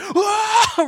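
loud, very high mock scream; crowd's startled yell. Pastor mimics crowd at gorilla's second charge, startled yell. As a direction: scream like the whole crowd at once. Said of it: "everybody again was like".

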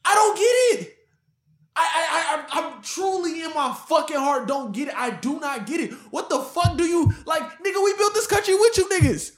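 high-pitched, heated outburst; emotional rant. Real, not acted. Demanding why his people got no reparations, emotional rant. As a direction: plead and protest, tripping over restarts. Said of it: "full, you know, emotional build up".

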